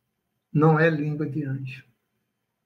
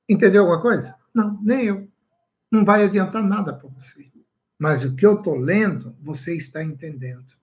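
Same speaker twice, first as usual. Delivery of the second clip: ordinary talk, nothing marked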